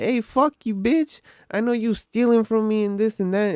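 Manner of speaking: high-pitched, confrontational accusation; attitude. Retold or imitated. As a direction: retell a customer storming in with attitude. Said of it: "like, some attitude".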